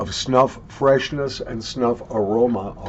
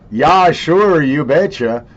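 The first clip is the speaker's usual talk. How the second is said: loud, higher, playful Minnesotan accent; 5 semitones higher, 8 dB louder than usual. Shown as a demonstration. Vlogger jokingly answering whether he has an accent, in Minnesotan accent. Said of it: "Do I have a Minnesotan accent?".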